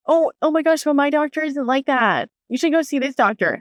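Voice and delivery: high-pitched, chirpy imitation of advice-givers; mock well-meaning friend. Friend recommending her own doctor, mocked in a high voice.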